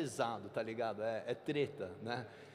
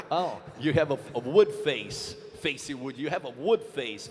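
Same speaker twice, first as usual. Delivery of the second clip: loud amused answer; 8 dB louder, slower than usual. Acted. [name] answers loudly, amused.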